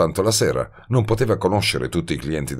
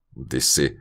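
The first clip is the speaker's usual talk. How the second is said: ordinary delivery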